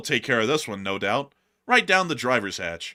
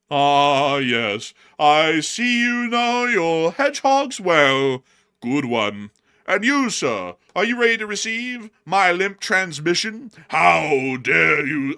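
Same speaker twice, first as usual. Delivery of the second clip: mock pompous gentleman; 5 semitones higher, 7 dB louder, slower than usual. Voices drone pilot in posh mock.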